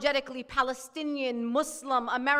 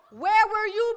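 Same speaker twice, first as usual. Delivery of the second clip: outraged retort; 5 semitones higher, 6 dB louder than usual. Retold or imitated. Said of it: "I was like".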